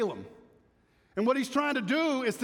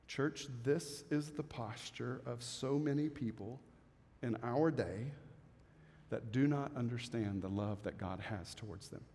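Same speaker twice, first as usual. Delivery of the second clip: much softer, lower heartfelt appeal; tender appeal; 9 semitones lower, 11 dB quieter than usual. Real, not acted. Preacher gently addressing the church, soft and low. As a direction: lean in and speak it gently.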